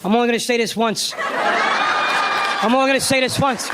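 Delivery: slow, heavy De Niro-style warning; menacing mob father; tough father. Retold or imitated. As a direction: drag out the threat. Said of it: "'cause he said".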